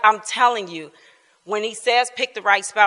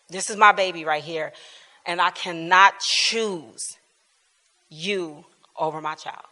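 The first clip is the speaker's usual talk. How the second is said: retold tearful plea; 4 semitones lower, 6 dB quieter than usual. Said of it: "I'm crying as I'm saying it".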